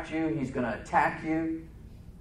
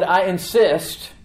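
loud, slow, higher-pitched authority; Paul; 6 semitones higher, 6 dB louder, much slower than usual. Retold or imitated. Preacher quotes Paul speaking with authority, insistent. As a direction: insist with immovable firmness. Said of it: "He spoke with authority".

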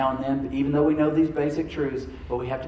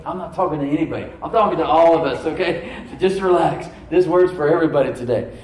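laughing reassurance; 3 semitones higher, 6 dB louder than usual. Real, not acted. Preacher laughing, reassuring congregation.

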